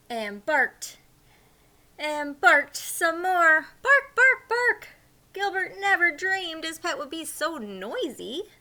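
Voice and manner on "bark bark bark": high, loud yappy barking; playful barking dog voice; dog barks. Acted. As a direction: bark like an excited little dog.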